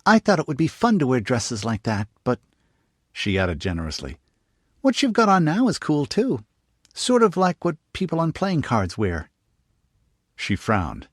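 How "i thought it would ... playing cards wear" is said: put-on high, eager little girl; young girl voice. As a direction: pitch it up like a curious young girl. Narrator voices young girl Jane.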